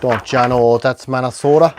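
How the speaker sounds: put-on Minnesota accent. Host trying the accent on request, mock Minnesota accent. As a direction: try a folksy Minnesota "don't ya know". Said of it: "Minnesota accent".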